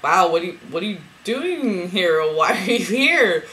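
stretched-out, swooping astonished questions. Retold or imitated. Greeting her twin flame in a dream, astonished.

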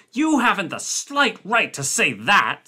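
high, sharp angry retort; snapping. A narrator's reading. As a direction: snap it back, offended. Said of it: "snapped Blair".

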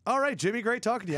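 re-enacted awkward exit line; polite brush-off. Acting out a hasty polite exit.

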